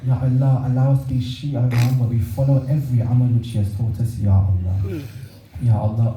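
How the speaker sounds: low-pitched, subdued prayer recitation; closing supplication prayer. Real, not acted. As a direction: lead the mourners in a low, solemn plea to God.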